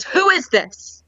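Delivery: mock demanding, drawn-out question; curt caller. Customer interrupting the phone greeting, demanding.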